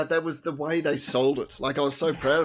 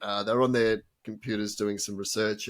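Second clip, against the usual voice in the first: ordinary talk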